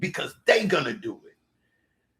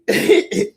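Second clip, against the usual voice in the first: high laugh; 8 dB louder than usual. Real, not acted. Friends laughing at story.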